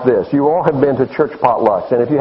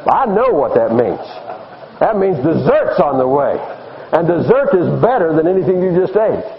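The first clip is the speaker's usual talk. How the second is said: ordinary talk, nothing marked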